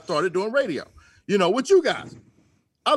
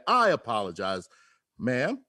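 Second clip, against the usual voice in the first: polite and much lower, a little pretended courtesy; mock polite; 8 semitones lower, slower than usual. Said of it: "very politely at first".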